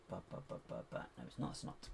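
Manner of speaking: hushed whisper to himself. Real, not acted. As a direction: breathe it out under your breath.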